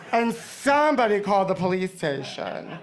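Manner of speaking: ordinary delivery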